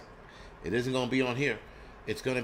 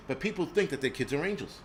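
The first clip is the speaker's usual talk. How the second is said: usual speaking voice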